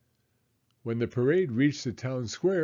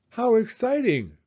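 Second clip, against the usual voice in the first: delighted exclaim; 10 semitones higher, 4 dB louder than usual. Acted. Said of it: "Missus Clayton said".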